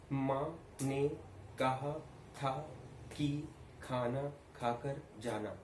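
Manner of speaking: माँ ने कहा था broken by pauses, each word weighted; stress on every word. Shown as a demonstration. Teacher demonstrating dialogue drill, stress on every word from माँ to जाना. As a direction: give माँ, ने, कहा, था each its own beat. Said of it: "हर शब्द पे proper stress देंगे".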